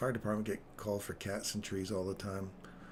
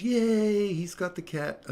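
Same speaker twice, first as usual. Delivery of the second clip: high, loud cheer fading to talk; cheering crowd, loud yay then settling; 10 semitones higher, 7 dB louder, slower than usual. Retold or imitated. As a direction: whoop like the crowd below. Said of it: "the crowd goes".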